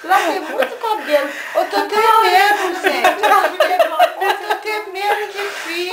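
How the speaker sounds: speaking through laughter; laughing. Real, not acted. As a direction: laugh the words out.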